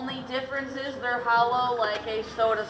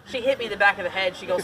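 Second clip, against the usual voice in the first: normal talking voice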